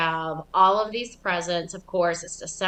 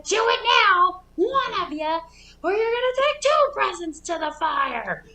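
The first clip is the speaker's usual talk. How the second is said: high, loud ultimatum; stern mom threat; 13 semitones higher, 4 dB louder than usual. Retold or imitated. Mom threatening the kids with a second present. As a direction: lay down the law.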